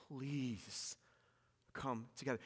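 almost breathe the plea to them; a soft, heartfelt plea, barely above a murmur; hushed plea. Retold or imitated. Paul's quiet final plea.

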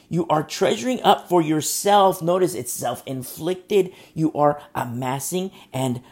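forceful preaching. Real, not acted. Preacher hammers point on wrath.

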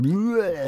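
jump-scare sound, played for laughs; mock monster bleh. Describing an animatronic to a friend, mock monster noise.

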